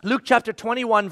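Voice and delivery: ordinary talk, nothing marked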